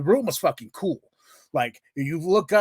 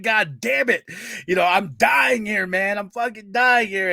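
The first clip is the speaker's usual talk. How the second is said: high, loud hammed-up complaint; comic desperate outburst; exasperated pleading; 6 semitones higher, 3 dB louder than usual. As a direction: protest as if dying of pain. Acting out agony and anger.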